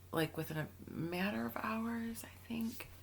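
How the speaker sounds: whispered aside. Real, not acted. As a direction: drop to a whisper. Drops to confiding aside.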